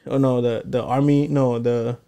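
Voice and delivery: normal talking voice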